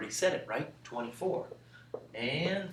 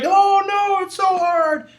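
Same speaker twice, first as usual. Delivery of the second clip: very high, loud mock wail; mock crying; 14 semitones higher, 10 dB louder, slower than usual. Kids dreading long division, hammed-up high wailing. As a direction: bawl it in exaggerated despair. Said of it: "Usually kids start crying".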